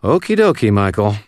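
high-pitched, skeptical humoring; sing-song, doubting agreement. A narrator's reading. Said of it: "exchanged a skeptical look with Sonya".

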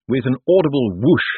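ordinary delivery

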